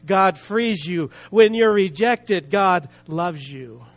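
emphatic, rhythmic preaching, each answer weighted. Real, not acted. Preacher declaring God's grace to the congregation, emphatic preaching. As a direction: proclaim each promise forcefully, slowing on the payoff.

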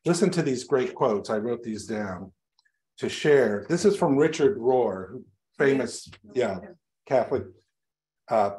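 normal talking voice

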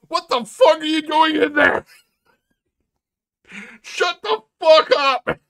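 high, loud mock shout through a door; imagined angry yelling. Someone pounding on the ranter's door, mock furious shout. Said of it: "hear somebody pound on a door and say".

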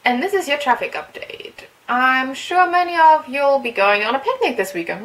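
bright, loud radio voice; reading a game's traffic report on air; radio host read. Acted. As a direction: read it like a morning DJ. Reads radio traffic update.

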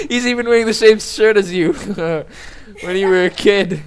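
words broken by laughter; laughing. Real, not acted. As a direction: laugh through the line. Laughing while teasing Sammy.

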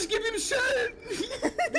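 high, slow laughing yell; laughing shout. Real, not acted. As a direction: shriek with laughter. Fighting back while chased.

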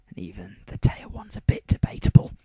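unvoiced whisper, confiding; whispered aside. Real, not acted. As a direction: whisper it under your breath. Self-deprecating aside, whispered.

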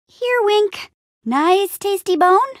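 high, sing-song pet talk; coaxing a dog. Acted. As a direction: tempt the dog with a treat in a high voice.

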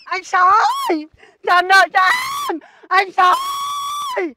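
long-drawn debt collector's shout; insistent yelled demand; yelling. Acted. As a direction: holler it out, stretching the last word. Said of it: "mà gào ghê thế".

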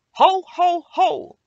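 high, very loud ho-ho-ho; big jolly storybook Santa holler; shouted Santa laugh. A narrator's reading. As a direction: call it out as Santa swooping from the sky. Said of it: "shouted Santa".

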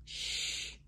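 soft whispered shush; hushing. A narrator's reading. Jack hushes Annie so the pirates won't hear. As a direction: hush her quickly.

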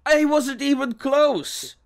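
high, loud, drawn-out protest; insistent denial. Acted. Officer to disbelieving inspector, protesting loudly. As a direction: protest your innocence at the top of your voice.

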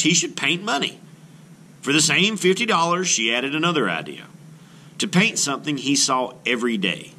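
storybook read-aloud, gently expressive; children's book narration. Acted. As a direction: read it like a picture book to a class. Reads picture book aloud.

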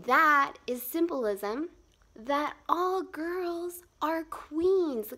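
drawn-out, proud proclamation; emphatic declaration. Real, not acted. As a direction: drive the point home deliberately. Making her big point.

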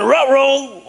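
high cartoon dog voice; dog caught out, cartoon style; Scooby-Doo dog. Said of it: "the dog went".

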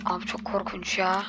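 whispered, frightened; scared whisper. Real, not acted. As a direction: hush it out in fear. Scared in dark game.

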